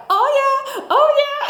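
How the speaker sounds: happy dance cheer. Retold or imitated. Consultant celebrating a booking with a happy dance, gleeful. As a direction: celebrate like you're dancing. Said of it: "I'm gonna do the happy dance".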